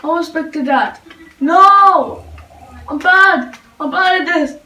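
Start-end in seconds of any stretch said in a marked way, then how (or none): 1.4-4.6 panicked shout. Real, not acted. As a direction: yell it in real panic. Panicking at losing fight.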